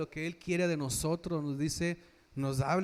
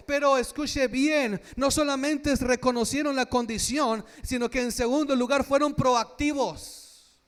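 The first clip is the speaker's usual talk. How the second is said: louder, driving pulpit preaching; preaching at full force; 6 semitones higher, 7 dB louder than usual. Acted. Pastor urging the brothers to seek God's word, fervent loud preaching. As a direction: build the sermon to a fervent peak.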